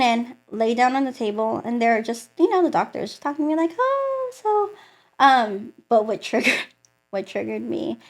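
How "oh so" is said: very high-pitched doctor voice; imitating chatty doctor. Doctor making small talk with patient, high-pitched imitation. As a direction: put on a high, airy medical small-talk voice.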